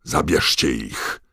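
slow, loud command; angry, spitting order; roared order. A narrator's reading. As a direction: bellow it to the guards. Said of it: "ryknął do dementorów, pryskając śliną".

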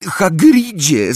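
very high, loud, terrified cry; frightened squeal. Acted. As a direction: squeal his name in panic. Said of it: "piskliwym głosem przerażona Hermiona".

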